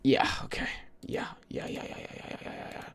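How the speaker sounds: barely audible, voiceless run of words; absent whispered muttering. Real, not acted. Streamer busy doing something, whispering absently under the breath. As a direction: whisper it to yourself, fading away. Mumbling to self while busy.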